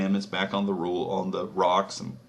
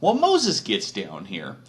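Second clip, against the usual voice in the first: usual speaking voice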